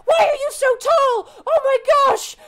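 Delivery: high, loud squealing questions; a tiny creature shouting in amazement; excited kobold. Acted. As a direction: kobold mobbing the tall stranger, high and shouting. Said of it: "the kobold's like".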